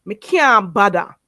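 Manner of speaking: slow Patois "Me can't bother", can't as "kyah", bother as "badda"; Jamaican Patois pronunciation. Shown as a demonstration. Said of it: "So you say".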